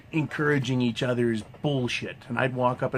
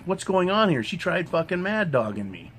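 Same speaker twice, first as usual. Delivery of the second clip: confronting; 6 semitones higher than usual. Retold or imitated. Confronting women at work.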